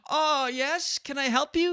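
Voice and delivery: high mock-wife voice answering the door; terribly acted wife. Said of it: "The terrible acting".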